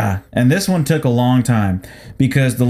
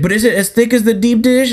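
mock whiny customer; 8 semitones higher than usual.

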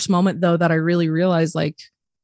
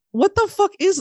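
very high-pitched disbelief; squeaky-high incredulous reaction; retold bewilderment; 14 semitones higher than usual. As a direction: act out baffled astonishment. Retold bafflement at dad laughing.

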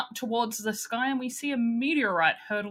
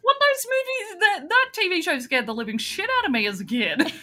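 laughing disbelief; 9 semitones higher than usual. Retold or imitated. Retelling shocked reply to niece.

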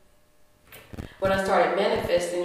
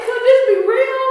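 much higher, louder wonder; awed "could this be real"; amazed disbelief; 9 dB louder than usual. Retold or imitated. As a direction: gasp it out in disbelief. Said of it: "I was like".

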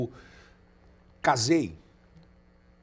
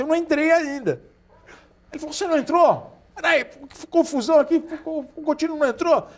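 much higher, surprised coach voice; 12 semitones higher than usual. Retold or imitated. Coach discovering the player never went in, surprised. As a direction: act the coach realizing the mix-up. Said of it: "o Dudu olhou e falou assim".

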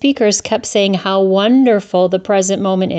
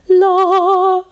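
much higher, lilting dismissive la; mock airy la; 13 semitones higher than usual. Mocking the enlightened guru, a high airy la. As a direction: trill it like a carefree guru.

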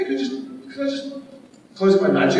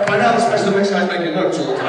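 usual speaking voice

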